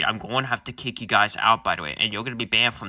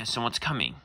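usual speaking voice

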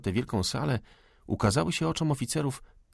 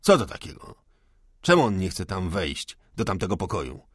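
sharp questioning; 4 dB louder than usual. Acted. Major demands why the cook won't enter, sharp and loud. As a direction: burst out loudly, then settle into questioning. Major reacts to servant's refusal.

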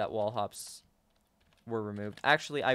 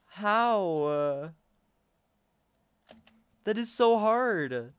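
high, loud, drawn-out whine; exasperated wail; 7 semitones higher, 4 dB louder, much slower than usual. Real, not acted. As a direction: wail it out in frustration. Fails a hard obstacle.

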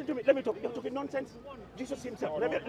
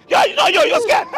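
mocking taunt, much higher and louder; 6 dB louder than usual. Real, not acted. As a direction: jeer that they're scared. Taunting opponent in street debate.

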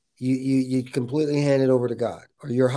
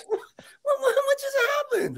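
far higher, squeaky laughing disbelief; stunned disbelief. Retold or imitated. Reliving the shock of failing step three, very high squeaky disbelief. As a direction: squeal it like you can't believe it.

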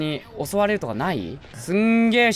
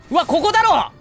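excited; 7 semitones higher, 5 dB louder than usual. Real, not acted. Finds the spot, excited.

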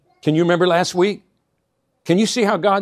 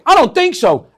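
put-on defiant child's no; 11 semitones higher, 8 dB louder than usual. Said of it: "I'd say".

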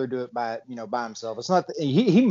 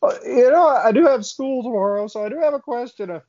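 put-on high, louder nervous teenager; nervous kid pitching up; high teen voice; 9 semitones higher, 6 dB louder than usual.

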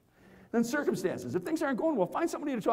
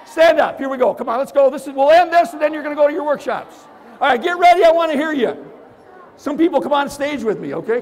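hyped-up crowd call; 6 semitones higher, 10 dB louder than usual. Real, not acted. Hypes crowd to stand up.

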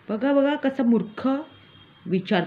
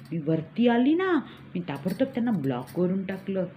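ordinary talk, nothing marked

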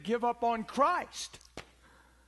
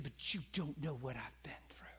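whispered lady's complaint; hushed imitation of a hurt lady; whispered retelling; 6 semitones lower, 10 dB quieter than usual. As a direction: breathe it like a wounded woman. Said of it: "A lady said".

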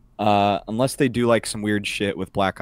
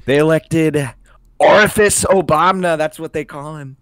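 higher, louder Trump rally voice; Trump impression; 6 semitones higher, 3 dB louder than usual. Trump complaining about Obama's election, Trump voice. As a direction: ham up a boastful grudge.

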